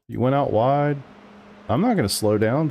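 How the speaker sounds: ordinary delivery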